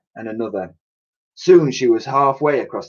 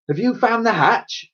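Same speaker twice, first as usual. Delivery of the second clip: higher, demanding professor; 11 semitones higher than usual. Acted. Professor demands whether Elsie found the hatch. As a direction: press her for an answer. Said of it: "demanded the professor".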